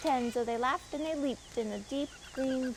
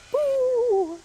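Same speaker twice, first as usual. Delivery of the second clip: high, loud ghost's Boo; ghostly shout; 12 semitones higher, 9 dB louder than usual. A narrator's reading. Spooks shouting in the dark forest, loud high Boo. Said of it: "where the spooks shout".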